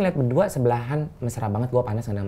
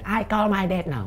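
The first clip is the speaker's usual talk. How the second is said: joking reenactment; 7 semitones higher, 5 dB quieter, slower than usual. Pretend. Joke reenactment of child phoning.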